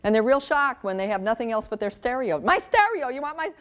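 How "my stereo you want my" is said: put-on high, outraged teen whine; whiny kid protest. Kid whining to parent about stereo. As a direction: play the indignant kid losing his stereo.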